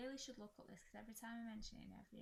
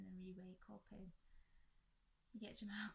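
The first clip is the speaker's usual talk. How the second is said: ordinary talk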